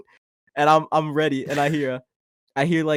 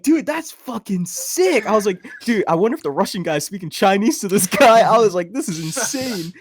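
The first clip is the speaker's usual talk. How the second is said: loud, high, blown-away retelling; hyped storyteller, pitched up; amazed excitement; 6 semitones higher, 7 dB louder than usual. Retold or imitated. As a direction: relive being totally amazed, loudly.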